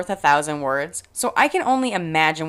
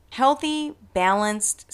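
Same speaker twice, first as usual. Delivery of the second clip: ordinary delivery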